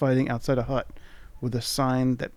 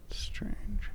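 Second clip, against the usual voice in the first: soft, higher whisper; puzzled whisper; 8 semitones higher, 8 dB quieter than usual. Real, not acted. Player whispering at a dead end. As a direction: breathe it out, puzzled. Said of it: "puzzled whispered aside".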